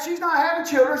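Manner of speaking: ordinary talk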